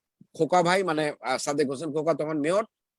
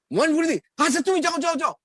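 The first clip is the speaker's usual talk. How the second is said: a boss jumping up, suddenly eager, much higher and louder; surprised boss; 11 semitones higher, 5 dB louder than usual. Retold or imitated. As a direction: boss suddenly high and loud on hearing the name. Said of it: "বস বলে কি". (চমকে ওঠা বসের উঁচু গলা)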